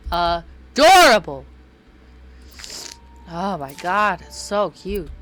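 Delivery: loud, drawn-out cry of delight; gushing outburst. Real, not acted. Player reacting to a love note, loud gushing. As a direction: belt it out slowly, utterly charmed.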